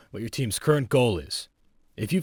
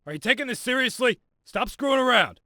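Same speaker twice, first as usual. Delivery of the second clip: higher-pitched exasperated rebuke; exasperated scolding; 8 semitones higher than usual. Acted. Instructor scolding trainee for screwing around, exasperated.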